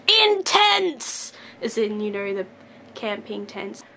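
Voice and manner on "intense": high, theatrical exclamation of the word; dramatic echo. Retold or imitated. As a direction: belt the word out like a catchphrase. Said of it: "my brain's just like".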